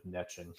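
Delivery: "niching" as NITCH-ing; American-style "niching", short i and a hard ch, not "neeshing"; American pronunciation. Real, not acted. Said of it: "use the American pronunciation".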